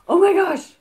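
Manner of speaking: high, loud oh my gosh; real excitement over a gift; thrilled. Real, not acted. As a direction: burst out with delight. Seeing the watch.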